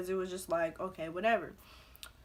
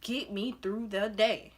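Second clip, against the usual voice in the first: "Get me through the day" drawn out word by word; slow emphatic plea; slower than usual. Retold or imitated. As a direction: spell out the plea slowly, each word weighted. Plea repeated slowly for emphasis.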